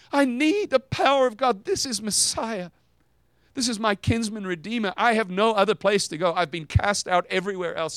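high, pleading voice of a desperate woman; desperate plea. Retold or imitated. A woman pleading with Jesus, high and desperate.

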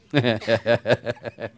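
loud laugh at own joke; hearty laugh. Real, not acted. Preacher joking about loving himself, hearty laugh. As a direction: burst out laughing, loudly.

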